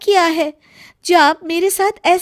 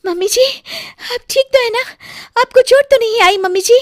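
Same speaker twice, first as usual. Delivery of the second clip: worried; 7 semitones higher, faster than usual. Acted. Worried after saving mother-in-law.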